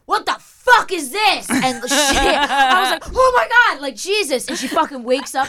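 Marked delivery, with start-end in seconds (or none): 0.1-1.5 loud, high outraged outburst; acted-out furious stranger; outraged yell. Woman in story erupts at her. 3.1-3.8 high, loud shocked yelp; acted-out fright; startled cry. Her startled reaction, retold.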